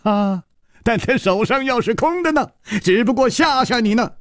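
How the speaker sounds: 笑着说. A narrator's reading. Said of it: "楚留香忽然笑了".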